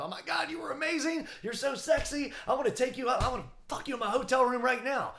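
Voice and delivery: high, breathless admirer voice; lightly voiced swooning fan; gushing woman. Woman gushing over the comedian, high mock swoon.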